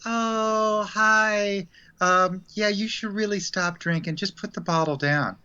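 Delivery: a hint of a kindly angel coaxing; sweet angel. Angel to an alcoholic, mild sweet angel voice.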